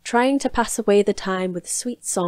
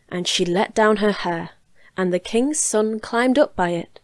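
usual speaking voice

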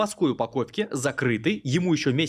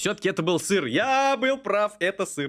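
triumphant; 6 semitones higher than usual. Real, not acted. Same words repeated.

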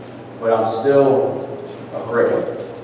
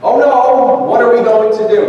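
panicked servant; 11 semitones higher than usual. Retold or imitated. Preacher voices the terrified servant to the church, high panicked cry. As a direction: shriek it like a servant who just saw the army. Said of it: "some language I can't repeat".

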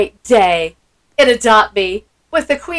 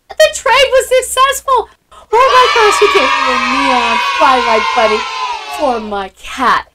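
thrilled shout; 4 dB louder than usual. Real, not acted. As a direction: shout it out, overjoyed. Trade succeeds, thrilled squeal.

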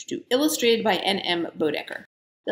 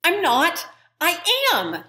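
higher, lightly voiced girl insisting; girl Jane protesting; 9 semitones higher than usual. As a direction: push back like an anxious girl. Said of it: "said Jane".

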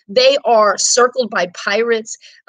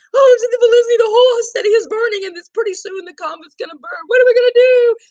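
much higher and louder mock panic; panicked nuns; 12 semitones higher, 4 dB louder, faster than usual. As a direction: shriek it like frightened nuns. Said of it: "And they said".

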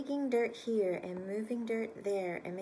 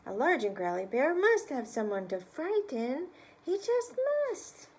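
lightly voiced bear, a bit louder; growly bear; 5 semitones higher, 5 dB louder than usual. Storyteller giving the bear a light growly voice. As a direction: grumble it like a put-out bear. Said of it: "said the large and growly bear".

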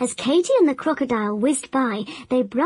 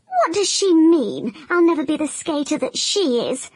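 higher, offended crocodile voice; indignant crocodile; 4 semitones higher than usual. Acted. Crocodile reading grandmother's letter, higher and indignant. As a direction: protest like a wounded champion. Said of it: "he cried".

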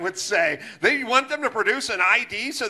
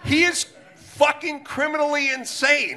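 ordinary talk, nothing marked

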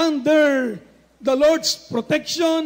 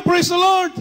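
loud, higher cry of praise; shouted praise; 5 semitones higher, 5 dB louder than usual. Retold or imitated. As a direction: let it ring out like the soldiers. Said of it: "sumigaw ng malakas na hallelujah".

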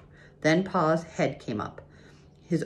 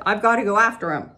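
urgent Pa; 5 semitones higher, 6 dB louder, faster than usual. A narrator's reading. Pa urgent in well crisis.

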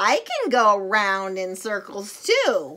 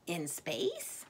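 whisper; 7 semitones lower, 17 dB quieter than usual. Acted. Whispering a hushed aside.

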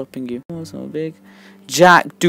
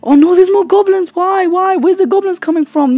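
high-pitched complaint at the game; dismayed wail; 12 semitones higher, 4 dB louder than usual. Real, not acted. Player seeing more goblins arrive, dismayed high wail.

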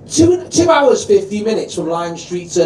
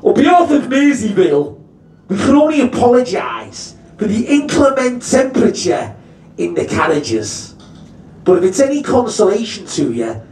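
Tony the driver. Retold or imitated. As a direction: put on a grand word then drop it. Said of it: "He goes".

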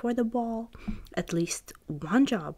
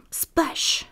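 louder whispered splash; splash sound; 7 semitones higher, 5 dB louder than usual. Acted. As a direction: burst out the splash. Sound effect in story.

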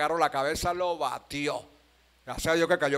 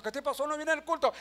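esposa preguntando; 9 semitones higher than usual. Retold or imitated. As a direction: voice a wife's puzzled question, pitched up. Voices the wife asking. (esposa que pregunta en voz más aguda)

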